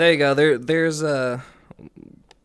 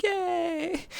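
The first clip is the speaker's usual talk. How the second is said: put-on much higher girlish yay; mock delighted squeal. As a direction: squeal like she's being twirled. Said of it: "she's like".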